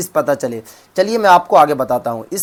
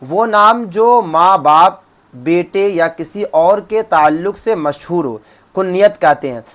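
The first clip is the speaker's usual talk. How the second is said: slow dictation; slower than usual. Shown as a demonstration. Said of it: "اب کنیت کیا ہے؟".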